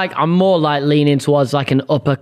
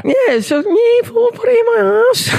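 upper class Scouse; 11 semitones higher than usual. Shown as a demonstration. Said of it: "what does that sound like?".

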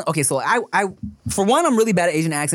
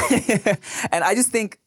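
ordinary delivery

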